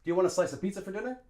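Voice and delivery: very fast "d'ya wanna slice-uh pizza fer dinner"; native linked speech. Shown as a demonstration. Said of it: "A native English speaker would say".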